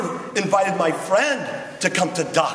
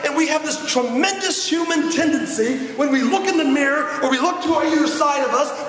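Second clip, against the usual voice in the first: rousing speech; 5 semitones higher than usual. Acted. Preaching to the audience.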